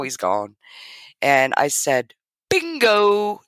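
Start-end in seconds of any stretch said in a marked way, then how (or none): 2.5-3.4 higher, punchy "Bingo."; excited bingo. Retold or imitated. She tells her new cousin who her dad was, excited. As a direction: announce it like a winning card. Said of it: "That's exactly how I said it".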